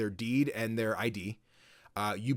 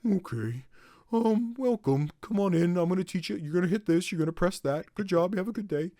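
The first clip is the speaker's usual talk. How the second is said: higher, upbeat put-on tutorial guy; cheerful tutor; 6 semitones higher than usual. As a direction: chirp instructions like a friendly guide. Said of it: "I gave him this".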